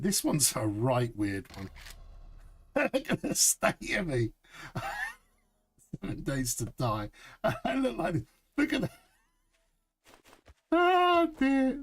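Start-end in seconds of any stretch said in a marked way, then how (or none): 2.8-8.2 giggling through the words at his own outfit; laughing at his silly pirate look. Real, not acted. 8.6-11.8 laughing. Real, not acted. Cracking up at the outfit.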